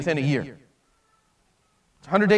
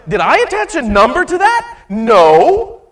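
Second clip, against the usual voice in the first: higher, louder rhetorical demand, then a firm no; emphatic challenge; 7 semitones higher, 8 dB louder than usual. Acted. Jesus pressing Peter on the number, loud emphatic challenge. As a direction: press the point hard, slam the answer.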